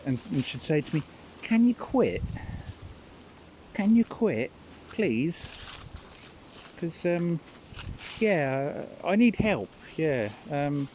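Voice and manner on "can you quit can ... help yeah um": put-on higher-pitched pleading old nan; a touch of a clingy old nan asking for help; imitating Nan. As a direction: plead like a needy grandmother. Grandmother's pleading voiced in story.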